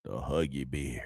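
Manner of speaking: low, soft put-on character voice; Huggy Beard. Host introducing a chat regular, in a low put-on voice. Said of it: "How you like my Huggy Beard voice?".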